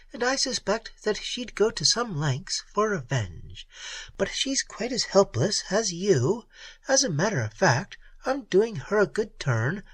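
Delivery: a hint of the cool Red Peri; lightly voiced Peri. A narrator's reading.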